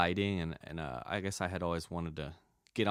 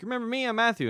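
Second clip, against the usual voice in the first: imitating Matthew in a much higher, eager voice; 5 dB louder, much faster than usual. Old acquaintance phoning after a year, high eager mock voice. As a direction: play the old acquaintance calling, pitched way up and hopeful. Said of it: "He's like".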